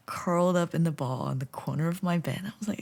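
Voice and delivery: ordinary talk, nothing marked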